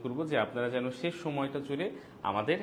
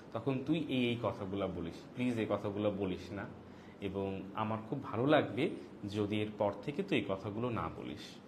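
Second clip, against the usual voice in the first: soft, unhurried words with pauses; gentle, with pauses; 4 semitones lower, 3 dB quieter than usual. Shown as a demonstration. Asking a friend over snacks to stop, gentle and soft. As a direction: ask kindly, pausing between thoughts. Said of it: "এইভাবে থেমে থেমে আস্তে আস্তে".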